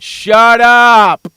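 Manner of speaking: loud, drawn-out yell; shouted shut-up. Acted. Host shutting down a silent Skip, loud drawn-out yell. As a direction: bellow it slowly to silence him.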